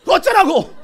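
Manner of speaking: high, loud comeback; defiant shout. Acted. Preacher answering a demon's accusation, defiant shout. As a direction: own it and throw it back brazenly.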